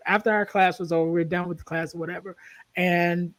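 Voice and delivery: ordinary delivery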